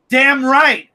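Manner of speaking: normal talking voice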